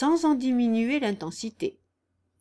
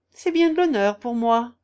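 louder, mock-honoured irony; ironic; 3 semitones higher, 7 dB louder than usual. A narrator's reading. Marsillat answers Raguet's remark, ironically. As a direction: feign being honoured, with irony. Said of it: "dit Marsillat d'un ton ironique".

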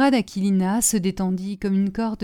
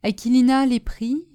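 plain reading voice

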